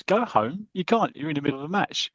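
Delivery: high, startled disbelief at a teammate leaving; he can't believe his ears; incredulous outburst. Retold or imitated. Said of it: "And Tommy goes".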